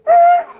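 high, loud buzzer-like "Er"; buzzer noise. Retold or imitated. God buzzing the preacher's plan, a high loud buzzer noise. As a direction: make a wrong-answer buzzer sound. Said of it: "And God goes".

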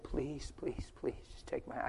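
breathy whispered plea, near tears; whispered pleading. Retold or imitated. Preacher to a cancer patient on a plane, whispered pleading. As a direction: beg him in a whisper to let you pray. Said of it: "I just pleaded".

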